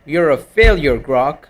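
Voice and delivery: slow, drawn-out verdict of failure; emphatic scolding. Real, not acted. User passing judgement on the voice assistant's try, emphatic scolding. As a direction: pronounce the failure slowly and emphatically.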